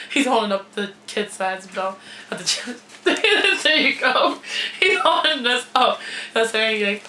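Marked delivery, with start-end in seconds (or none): 3.0-3.6 a quick high burst of laughter; short high laugh. Real, not acted. Laughing at Jonathan holding up the kid belt, short laugh. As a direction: laugh out at the cameraman. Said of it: "I'm laughing so much".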